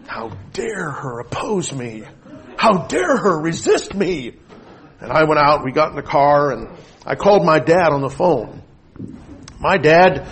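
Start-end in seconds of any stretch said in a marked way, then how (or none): 0.1-4.3 slow mock outrage, rising in pitch; slow, theatrical fuming; fuming indignation. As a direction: seethe with hammed-up indignation, drawing the words out. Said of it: "because I was fuming".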